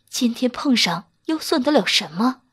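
usual speaking voice

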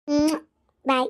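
ordinary delivery

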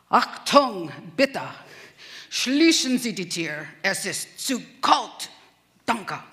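barked, staccato German commands; mock military German. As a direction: order them about in stiff army German. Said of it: "I think you're speaking military German".

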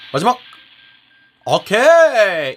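high loud drawn-out okay; excited okay. Real, not acted. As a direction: stretch it out in delight. Good pull reaction.

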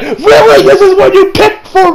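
put-on high, appalled "really?" in a boy's voice; incredulous kid. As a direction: sound shocked at the choice. Kid appalled at strip club choice.

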